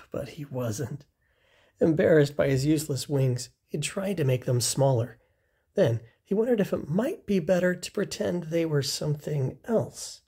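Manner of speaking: usual speaking voice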